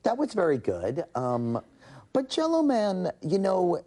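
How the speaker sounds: high, careful mock politeness; casting director lady. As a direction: tactfully give notes in a put-on lady's voice. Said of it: "the casting director lady went".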